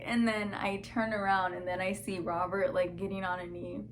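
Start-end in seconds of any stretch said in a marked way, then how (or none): none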